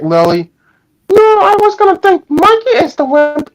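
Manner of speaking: usual speaking voice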